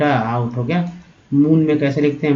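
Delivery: ordinary talk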